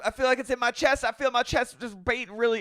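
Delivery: high, loud mock distress; Brittany. Wife complains of her chest to husband, mock distressed. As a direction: whine in hammed-up physical misery. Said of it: "She goes".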